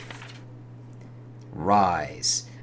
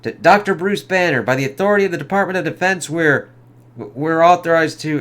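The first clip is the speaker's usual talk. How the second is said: raised, higher, louder, rushed and urgent; soldier's panicked call; 7 semitones higher, 7 dB louder than usual. Acted. Soldier reading out orders to Banner, loud and panicked. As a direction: blurt it out like a scared soldier.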